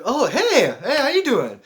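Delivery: high, loud, surprised hello; lifted, effusive "hey!"; delighted greeting. Retold or imitated. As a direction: greet a new buddy with hammed-up glee.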